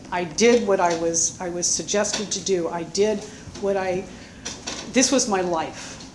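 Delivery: usual speaking voice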